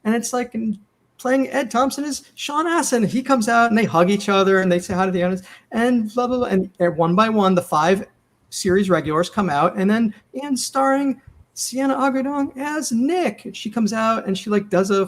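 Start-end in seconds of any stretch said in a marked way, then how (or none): 1.2-3.0 high-pitched imitation of a cast announcer; warm-up guy. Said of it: "the warm-up guy is like". 10.4-13.5 high, slow announcer build-up to the star's name; warm-up guy. Retold or imitated. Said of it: "the warm-up guy is like".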